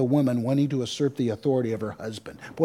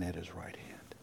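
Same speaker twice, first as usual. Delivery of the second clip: whisper; 7 semitones lower, 21 dB quieter than usual. Real, not acted. Sermon aside trailing.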